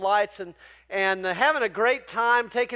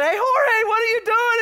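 much higher and louder, a delighted hey; excited greeting; 12 semitones higher, 6 dB louder than usual. Retold or imitated. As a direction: call out to an old friend, thrilled. Retold greeting of old friend.

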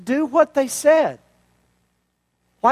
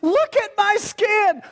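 much higher, slow, delighted cry; overjoyed Naaman; 10 semitones higher, slower than usual. Acted. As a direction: show off your new skin in wonder. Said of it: "Look what he says".

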